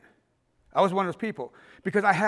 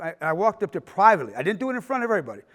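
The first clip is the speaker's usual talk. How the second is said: ordinary delivery, nothing marked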